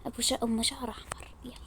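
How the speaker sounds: hushed, breathy scheming aside; whispered aside. Acted. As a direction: whisper it so no one hears the plan.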